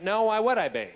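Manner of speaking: lightly camp, emphatic comeback; buddy's camp reply. Retold or imitated. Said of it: "And he went".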